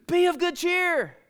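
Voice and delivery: high, slow, ringing announcement; jubilant proclamation. Shown as a demonstration. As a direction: proclaim it with joy. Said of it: "Paul jumps up and goes".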